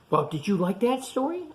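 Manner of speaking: ordinary delivery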